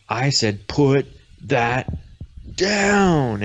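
fed-up daughter putting her foot down; exasperated command. Acted. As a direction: order them sternly, landing hard on the last word. Acted quarrel, daughter snaps at mother.

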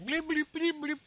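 high chirpy droid beeps; droid beeping. Retold or imitated. Imitating the mouse droid, high chirps. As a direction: chirp like a tiny robot.

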